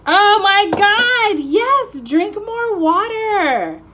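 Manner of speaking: high, loud, slow excited exclaim. Real, not acted. Reader thrilled the card confirms her message, excited and loud. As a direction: shout the confirmation with joy.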